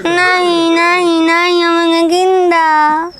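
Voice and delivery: slow, drawn-out wailing whine; whining refusal. Acted. Wife nagging husband for a dog, whining. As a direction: draw each word into a pleading moan.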